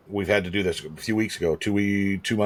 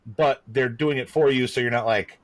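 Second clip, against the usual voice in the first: usual speaking voice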